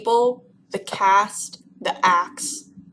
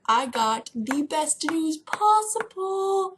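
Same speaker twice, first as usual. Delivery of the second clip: slow, drawn-out big reveal, pitched higher; grand announcement; 7 semitones higher, much slower than usual. Acted. Vlogger teasing viewers with big news, slow grand reveal. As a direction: oversell the news like a trumpet fanfare. Hyping news, then taking it back.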